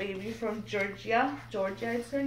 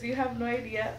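ordinary delivery

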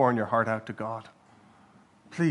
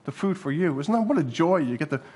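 normal talking voice

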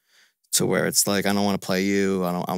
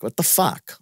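mildly exasperated, a touch higher; contained exasperation at normal volume; 4 semitones higher, slower than usual. Retold or imitated. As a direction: voice a baffled "what the fuck". First take of exasperated reaction.

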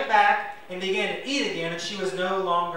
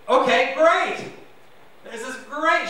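higher, louder, slow glad "Okay, great"; relieved and glad; 6 semitones higher, 5 dB louder, slower than usual. Retold or imitated. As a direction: Hannah taking the blessing with a lightly acted joy. Hannah's relieved reply voiced.

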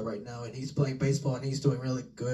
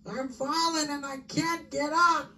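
put-on much higher, slow, helpless old woman's plea; old lady ad voice; 12 semitones higher than usual. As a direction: quote the famous ad like a frail grandma. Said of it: "you hear this".

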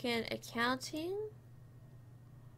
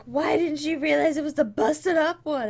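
loud, high imitation of exasperated viewers; hammed-up shouting at the screen; mock viewer yelling; 6 semitones higher, 12 dB louder than usual. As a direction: yell it like an annoyed audience. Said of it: "probably yelling at me like".

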